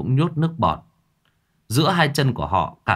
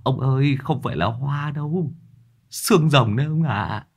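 lightly voiced second soldier, pitched higher; stammering colleague; 4 semitones higher than usual. A narrator's reading. Second soldier correcting his colleague, mildly stammering in a higher voice. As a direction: answer in a higher, hushed aside. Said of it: "Người kia cũng lắp bắp".

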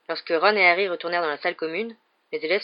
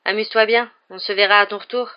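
louder, higher send-off; raised parting wish; calling out; 3 semitones higher, 7 dB louder than usual. A narrator's reading. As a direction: call after a departing friend. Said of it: "cria Harry à Ron".

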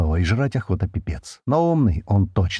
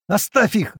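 much higher, frantic cry; hysterical scream; 11 semitones higher, slower than usual. A narrator's reading. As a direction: scream in panic to stop him. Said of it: "в истерике закричала Кали".

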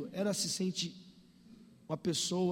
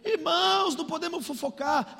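high-pitched imitated sermon call; preaching pastor; 7 semitones higher than usual. Pastor forbids gossip, high preaching voice. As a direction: open with a raised call to the flock. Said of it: "o pastor tava pregando".

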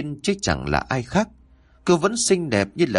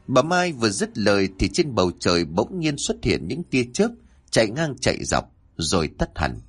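usual speaking voice

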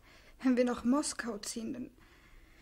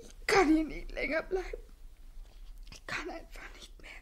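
tearful breakdown; 3 semitones higher than usual. Acted. Breakdown with crying.

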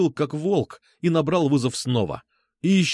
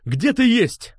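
raised, high angry demand; growled demand; 9 semitones higher, 4 dB louder, much slower than usual. A narrator's reading. Сергей demands Алёна's whereabouts, growling. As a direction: growl the question forcefully. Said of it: "прорычал я".

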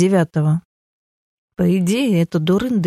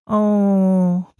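long regretful "oh"; drawn out. A narrator's reading. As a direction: stretch it out, sorry you let it slip. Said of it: "протянула девушка". (протяжное, сожалеющее «о»)

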